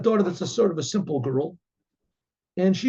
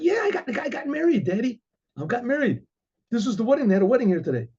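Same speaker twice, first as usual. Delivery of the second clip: put-on much higher, innocent girl voice; girlish daughter; 6 semitones higher than usual. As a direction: chirp it out, pleased with herself. Daughter explains to father.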